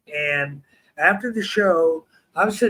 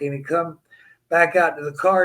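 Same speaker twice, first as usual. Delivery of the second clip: ordinary talk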